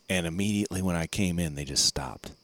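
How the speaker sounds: ordinary talk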